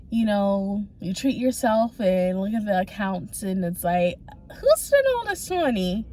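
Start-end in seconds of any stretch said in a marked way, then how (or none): 4.6-6.0 high-pitched mock shock at the spending; mock indignation. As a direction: feign outrage, pitched well up. Acts out shock at accounts.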